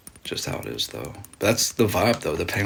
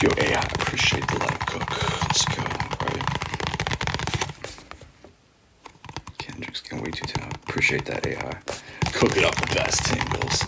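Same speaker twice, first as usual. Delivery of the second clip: ASMR whisper. Acted. ASMR streamer thanking donors in a whisper. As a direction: whisper the thanks close and intimate.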